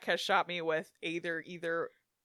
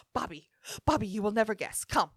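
imitating a Ukrainian-raised kid's Eastern European accent, building to a loud eager call; kid's excited Eastern European accent, getting louder on "I must show you"; 3 semitones higher, 20 dB louder than usual. Storyteller imitates little Al inviting Bobby in, eager Eastern European accent. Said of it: "they're so Eastern European and funny".

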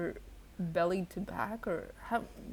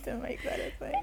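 whispered aside; 6 semitones higher, 3 dB quieter than usual. Real, not acted.

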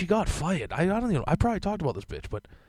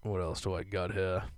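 normal talking voice

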